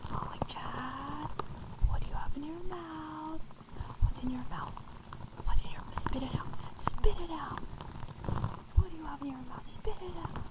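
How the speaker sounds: scolding a pet dog, whispered; stern playful whisper, close-mic; whispered asmr role play. Acted. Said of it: "the pet dog A S M R roleplay".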